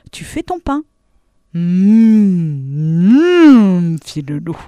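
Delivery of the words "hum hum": long, loud hum of greedy delight; drawn-out yummy moan; hungry humming. A narrator's reading. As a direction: swell the hum with appetite. Hungry wolf at thought of bread.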